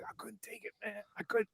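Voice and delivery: imitating a near-voiceless, choked whisper; choking up. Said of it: "he's choking up".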